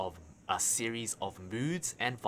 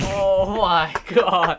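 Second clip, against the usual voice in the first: loud, high, very drawn-out groan of disbelief; exasperated outcry; 9 semitones higher, 13 dB louder, much slower than usual. Real, not acted. Co-host hearing the silly backstory, loud drawn-out disbelief. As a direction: stretch every word in amazement.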